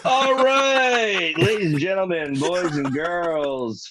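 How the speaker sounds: high, drawn-out showman's call; mock announcer. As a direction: announce it like a ringmaster opening the show. Co-host steps in as mock announcer.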